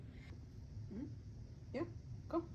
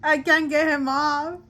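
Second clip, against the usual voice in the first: panicked; 3 semitones higher, 9 dB louder than usual. Real, not acted. Bird stuck on shoulder.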